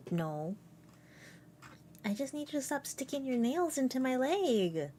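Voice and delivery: ordinary talk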